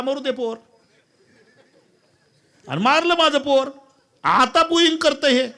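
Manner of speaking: high, loud village woman's voice; old woman testifying. Acted. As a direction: blurt out the confession. Old woman confessing in court.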